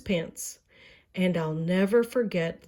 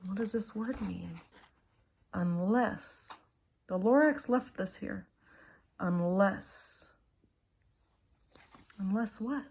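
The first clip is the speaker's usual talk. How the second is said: soft musing; 5 dB quieter than usual. Real, not acted. Parent musing softly with child.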